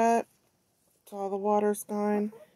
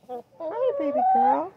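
high, louder sing-song coo; 10 semitones higher, 5 dB louder than usual. Real, not acted. Woman greeting her hen in a high baby voice.

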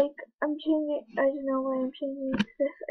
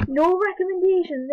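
exasperated; 5 semitones higher, 6 dB louder than usual. Real, not acted. Choosing new password.

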